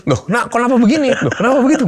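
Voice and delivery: high, loud startled protest; mock dismay. Father protesting to his child about the trip plan, mock dismayed. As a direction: act out a flustered dad objecting.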